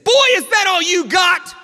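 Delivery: high, slow angry shout; furious imitated taunt at full volume; mad. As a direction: bellow it as an enraged challenger. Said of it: "and now he was mad".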